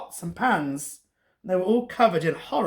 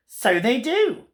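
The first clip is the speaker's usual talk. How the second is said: higher-pitched gleeful storybook villain; wicked wizard; 5 semitones higher, 3 dB louder, slower than usual. A narrator's reading. Wizard answers princess before casting his spell, wickedly.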